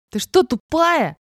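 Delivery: high, loud, drawn-out angry question; enraged yell. A narrator's reading. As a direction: yell it at her in fury. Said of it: "заорал Эдик взбешённый".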